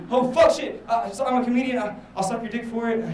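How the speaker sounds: high, loud flustered backpedaling; comic panic. Acted. Comedian pleading with a buff dude for his stool, mock-panicked and high. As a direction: blurt it out in a hammed-up fluster.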